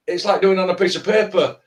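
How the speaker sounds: ordinary delivery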